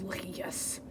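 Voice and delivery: very soft, low whispered retake; whispered villain. Acted. Villain conceding a point, low hushed whisper. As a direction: drop it to a sinister whisper.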